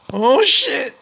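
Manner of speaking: loud startled yell; startled shout. Real, not acted. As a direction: shout it in sudden panic. Sudden danger in game.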